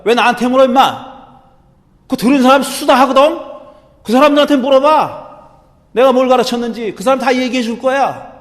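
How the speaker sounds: high, loud defiant retort. Retold or imitated. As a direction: talk back to your interrogator. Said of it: "이게 거의 저항하는 그런 어투로 얘기한 거예요".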